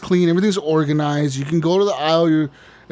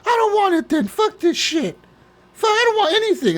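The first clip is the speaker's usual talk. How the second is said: much higher, indignant customer voice; shrieking-style mock Karen at the till; angry customer. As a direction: caricature of a furious shopper complaining. Customer storms off.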